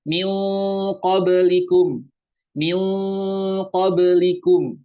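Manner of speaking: "min qablikum" drawn out, "min" held as nasal "ming"; ikhfa with ng sound. Shown as a demonstration. Teacher giving the example, "min" said as long "ming". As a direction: slowly chant "min qablikum", holding NG in "min". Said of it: "Contohnya apa?".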